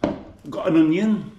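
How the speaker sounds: ordinary talk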